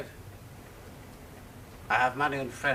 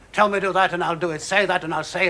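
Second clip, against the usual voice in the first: louder, pressing demand; loud urging; 6 semitones higher, 8 dB louder than usual. Acted. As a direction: push her hard to say the word. Urging her to decide.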